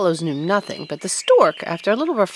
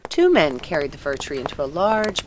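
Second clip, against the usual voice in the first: usual speaking voice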